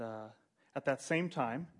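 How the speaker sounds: usual speaking voice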